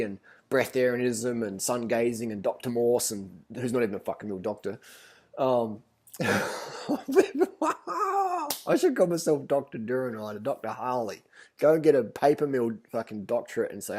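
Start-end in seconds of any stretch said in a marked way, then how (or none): 6.2-8.6 a sudden squeaky high laugh, far higher than the voice; high burst of laughter. Real, not acted. Laughing at fake doctors.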